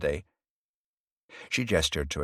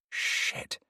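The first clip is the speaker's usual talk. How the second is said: whispered curse; 5 dB quieter than usual. A narrator's reading. Private curse under her breath.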